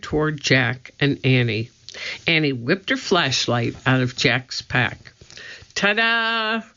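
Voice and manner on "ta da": high, showy ta-da; triumphant yell. Acted. Annie showing off her flashlight to frightened guards, high and triumphant. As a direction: announce it like a magic trick. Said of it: "she yelled".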